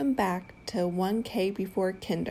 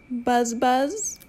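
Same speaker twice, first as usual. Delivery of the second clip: bee buzz; 4 semitones higher, 3 dB louder than usual. Pretend. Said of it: "Mister Brown can buzz".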